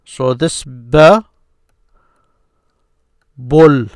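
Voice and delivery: ba then bul drawn out slowly; teacher slowly joining the sounds; slow joining demo. Shown as a demonstration. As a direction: stretch ba into bul. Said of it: "That's why I will join these".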